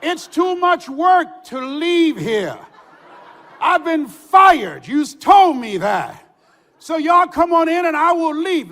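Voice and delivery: loud, slow, defiant yelling; defiant shout. Retold or imitated. Fired DJ refusing to come out to the police, loud defiant shout. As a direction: shout it back at the cops.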